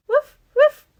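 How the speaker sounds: puppy barking. Pretend. Doll puppy barks at its new house, high yappy. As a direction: play the excited toy puppy. Voicing the dog Roycey.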